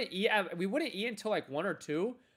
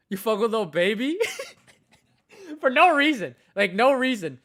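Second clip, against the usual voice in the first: cracking up, higher and louder, while quoting the question; laughing; 7 semitones higher, 9 dB louder than usual. Real, not acted. Telling friends about Turbo's question, laughing hard. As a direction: laugh through it, can't hold it together.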